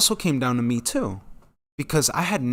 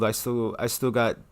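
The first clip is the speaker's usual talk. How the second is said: ordinary talk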